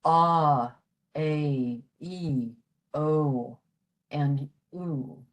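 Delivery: each long vowel "ah", "a", "e", "o", "oo" drawn out on its own; teacher reading long Irish vowels aloud; long vowel demo. Shown as a demonstration. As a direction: sound out "ah", "a", "e", "o", "oo" one by one like a teacher. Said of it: "with the pronunciations".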